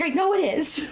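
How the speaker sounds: high-pitched mock retort; imitated tense reply. Friend retelling a driving argument, high mock retort. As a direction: throw it back high, half laughing.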